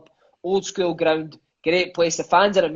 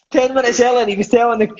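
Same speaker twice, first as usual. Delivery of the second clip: normal talking voice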